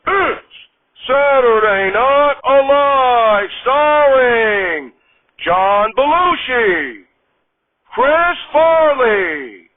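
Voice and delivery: high, loud, very slow stretched announcer call; late-night TV opening announcer; show announcer. Acted. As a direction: boom out each name to the crowd.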